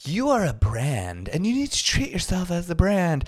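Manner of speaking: mocking the mantra. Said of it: "mantra or whatever, like, of now is like".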